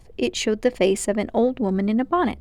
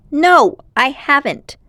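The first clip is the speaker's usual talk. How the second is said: put-on loud, slow, raised-pitch denial of a boy; indignant; 6 semitones higher, 8 dB louder, slower than usual. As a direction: bristle at the accusation. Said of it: "said Diggory indigently".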